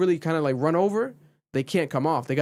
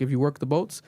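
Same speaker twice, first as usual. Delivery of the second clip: ordinary talk, nothing marked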